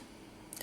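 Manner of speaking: sharp whispered gasp; mock gasp. Gasping as she tells him she has landed. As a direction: suck in air like you're shocked. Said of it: "she gasped".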